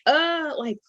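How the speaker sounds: imitating her own alarmed self; mock alarmed. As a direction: play the startled onlooker, high. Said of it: "I was definitely like".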